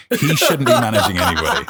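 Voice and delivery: laughing through it, loud. Real, not acted. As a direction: laughs out the words. Laughing about friend.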